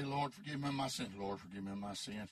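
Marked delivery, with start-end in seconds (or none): 1.2-2.3 much lower and flat, echoing the prayer; a dry, low, emotionless echo. Retold or imitated. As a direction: repeat it back low and dry, no feeling. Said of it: "dry, dry, low voice".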